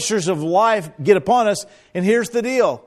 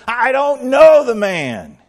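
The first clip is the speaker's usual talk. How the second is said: higher, louder and slow, biting off each word; emphatic denial; 8 semitones higher, 7 dB louder, slower than usual. Acted. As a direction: deny it hard, slowly and loud. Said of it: "denied it again with an oath".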